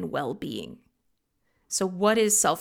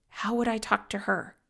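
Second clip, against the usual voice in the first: ordinary talk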